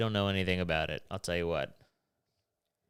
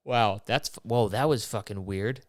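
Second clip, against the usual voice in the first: surprised; 5 dB louder than usual. Real, not acted. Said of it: "then I yawned. That's fucking crazy".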